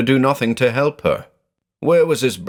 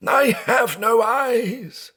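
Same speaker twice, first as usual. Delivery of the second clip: even higher, slow wailing with stress on I; sobbing cry, higher; slower than usual. Acted. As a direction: break further into the wail.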